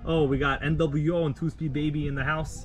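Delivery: ordinary talk, nothing marked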